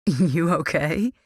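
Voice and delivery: laughing. A narrator's reading. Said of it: "he laughed".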